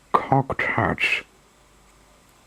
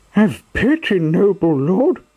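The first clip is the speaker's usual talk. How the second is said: high pleading puppet voice, much higher; supplicating voice; 10 semitones higher than usual. Acted. Pinocchio begging the showman, high pleading. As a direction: beg for mercy in a high voice. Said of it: "in a supplicating voice".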